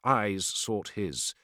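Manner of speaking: usual speaking voice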